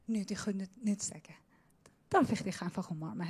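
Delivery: put-on whispering to a beloved child. Shown as a demonstration. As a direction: drop to a whisper. Preacher demonstrates God's whisper.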